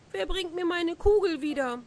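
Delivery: a lightly voiced weeping girl; a touch of despair in the question; tearful cry. A narrator's reading. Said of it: "Wie weinte sie sogar entsetzlich".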